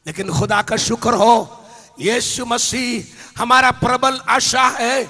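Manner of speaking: rising to loud, high shouting, slowing at the peaks; preaching at full force. Acted. Preacher proclaims hope in Jesus, building to shouts. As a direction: build until you are crying out to the crowd.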